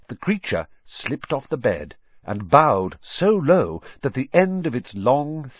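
ordinary delivery, nothing marked

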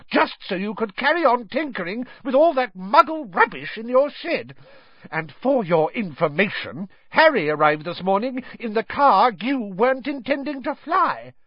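high-pitched angry tirade; shouting. A narrator's reading. Wife scolding husband over his tinkering, angry shout. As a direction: keep scolding at full pitch. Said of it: "shouted Missus Weasley".

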